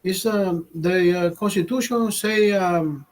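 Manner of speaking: squeaky high Mexican-accent impression of the coworker; imitating Mexican accent. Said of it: "sounds like a Mexican when he talks".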